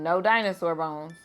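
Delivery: usual speaking voice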